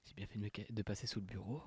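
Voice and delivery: ordinary delivery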